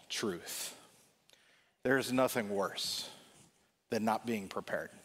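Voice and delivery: quiet, solemn pulpit emphasis; earnest sermon point. Acted. Sermon point, slow and soft.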